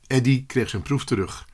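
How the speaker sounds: plain reading voice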